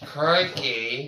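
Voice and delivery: Aussie "Crikey" with a loud lift; Australian accent. Pretend. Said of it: "'cause I got my Australian accent".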